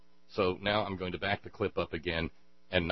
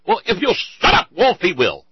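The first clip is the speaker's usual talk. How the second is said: high, loud shout at the interviewer; shouting at the TV anchor to shut up; exasperated yell; 11 semitones higher, 17 dB louder than usual. Real, not acted. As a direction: bark at Wolf to stop cutting in. Host yells at interrupting anchor.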